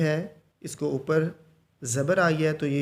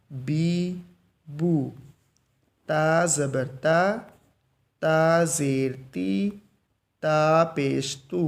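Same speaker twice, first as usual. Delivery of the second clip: calm drawn-out syllable drill; slow drill recitation; much slower than usual. Shown as a demonstration. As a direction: chant each syllable slowly, no jerk. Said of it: "बड़े आराम से मैं पढ़ रहा हूँ". (आराम से धीमा सबक़)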